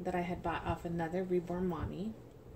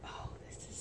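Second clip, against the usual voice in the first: whisper; 12 dB quieter, faster than usual. Real, not acted. Admiring a tiny romper.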